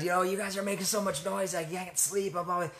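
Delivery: upset neighbor complaining. Retold or imitated. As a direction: act out the irritated neighbor woman, lightly. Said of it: "she was really upset".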